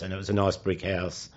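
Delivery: ordinary talk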